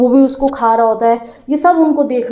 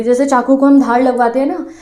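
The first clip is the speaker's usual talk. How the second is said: ordinary delivery, nothing marked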